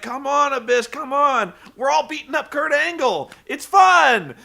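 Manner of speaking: egging a buddy on to join in; playful imitation of a wrestler calling his friend over; Ric Flair cheering. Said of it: "He's like".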